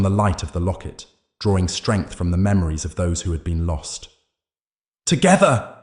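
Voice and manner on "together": high, loud rallying cry; rallying shout. A narrator's reading. Hero rallying the townsfolk, high loud shout. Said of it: "he shouted".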